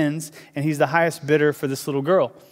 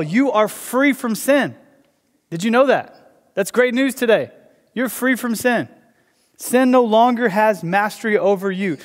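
higher, impassioned preacher's proclamation; preaching at full force; 3 semitones higher, 3 dB louder than usual. Acted. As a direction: proclaim freedom from sin to the congregation. Preaches the good news.

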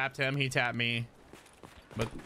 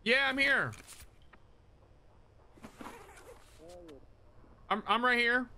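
calling out; 11 semitones higher than usual. Real, not acted. Answering friend over game voice chat.

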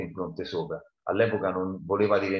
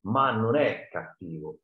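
plain reading voice

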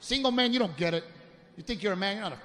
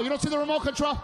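higher, raised voice insisting he's calm; exasperated yelling; 8 semitones higher than usual. Retold or imitated. Husband on phone yelling he's not yelling at wife. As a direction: yell while denying yelling. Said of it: "I'm not yelling".

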